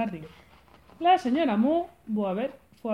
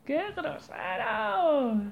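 higher, slow, offended; indignant; 8 semitones higher, much slower than usual. Acted. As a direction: drag out the outrage. Mock indignation, ending repeat.

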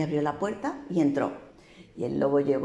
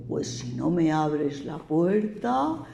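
slow, drawn-out, louder wolf threat; slow menacing wolf threatening the pig; huffing wolf threat; 4 semitones higher, 3 dB louder, much slower than usual. A narrator's reading. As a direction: stretch every soplaré like a menacing wolf. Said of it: "Y el lobo dijo".

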